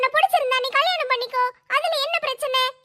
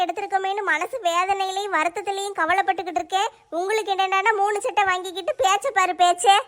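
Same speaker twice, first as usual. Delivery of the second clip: lower, nagging wife asking for grocery money; wife's voice; 7 semitones lower than usual. Acted. Wife asking husband for shopping money, nagging. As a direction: play the demanding wife. Wife complains about saris.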